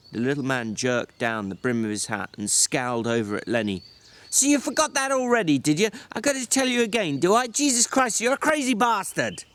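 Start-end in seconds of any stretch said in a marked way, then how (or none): 4.3-9.4 a weary ranch hand berating his companion; George, exasperated. Acted. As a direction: snap at him like a fed-up older brother. George scolds Lenny.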